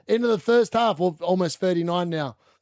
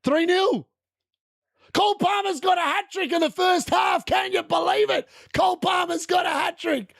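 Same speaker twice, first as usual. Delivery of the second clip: high, shouting disbelief at the hat-trick; overjoyed shout; excited shouting; 10 semitones higher than usual. Real, not acted. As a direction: yell it out, thrilled. Palmer hat-trick, excited outburst.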